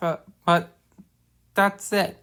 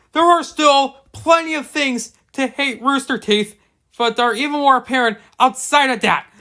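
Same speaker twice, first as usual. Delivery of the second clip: higher, louder angry rant building to a shout; ranting yell; 6 semitones higher, 4 dB louder than usual. Real, not acted. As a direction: unload on the episode, climbing to a yell. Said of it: "why the hell you were yelling".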